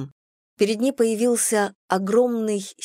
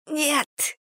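high strangled gasp; wind knocked out; 8 semitones higher, 3 dB quieter than usual. A narrator's reading. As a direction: squeeze out a choked no. Joan refuses while choked.

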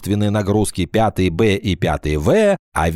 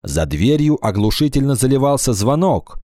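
ordinary delivery

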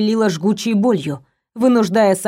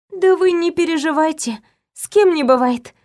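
shy reassuring; 7 semitones higher than usual. A narrator's reading. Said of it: "улыбнулась застенчиво и примирительно".

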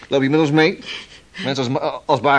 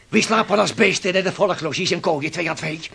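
normal talking voice

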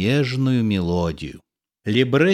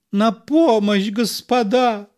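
cry for help; 10 semitones higher than usual. Acted. Actor calls for help.

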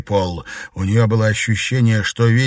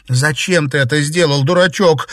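tearful; 5 semitones higher, 5 dB louder than usual. Acted. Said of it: "заливаясь слезами, прошептала девушка".

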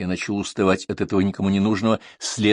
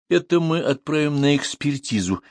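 higher, mildly displeased remark; 7 semitones higher than usual. A narrator's reading. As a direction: show official displeasure. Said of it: "недовольно заметил комиссар". (недовольно)

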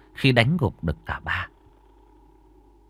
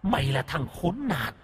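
much softer, hollow ghost; ghostly voice; 3 semitones higher, 9 dB quieter than usual. Acted. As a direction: speak as a vengeful spirit. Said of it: "giọng âm u, ma quái".

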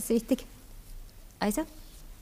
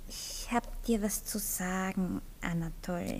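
much softer and slow, grave; serious and soft; 4 semitones lower, 11 dB quieter than usual. Acted. Anni about to tell Anatol something important, soft and slow. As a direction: lower the voice, slow and weighty.

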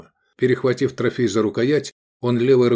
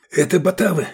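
hurried, higher-pitched warning; breathless gabble; 7 semitones higher than usual. A narrator's reading. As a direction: blurt it out in alarm. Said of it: "протараторил над духом запыхавшийся Искандер". (запыхавшейся скороговоркой)

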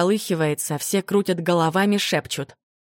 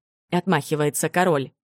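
ordinary delivery, nothing marked

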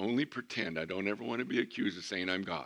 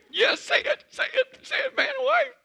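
squealing far higher and louder, laughing; excited bride; 9 dB louder than usual. Retold or imitated. Bride urging the pastor to pronounce them, in excited squeals. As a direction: shriek it like a giddy bride jumping up and down. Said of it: "she was so excited".

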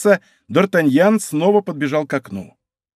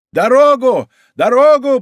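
high, loud cries demanding passage; shouting; 9 semitones higher, 6 dB louder than usual. A narrator's reading. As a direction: bellow to clear the way. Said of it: "продолжали кричать товарищи Менвиля".

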